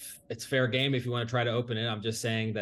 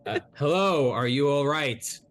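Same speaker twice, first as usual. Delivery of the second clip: yelling down a well; 4 semitones higher, 4 dB louder, slower than usual. Acted. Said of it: "I yell into the well".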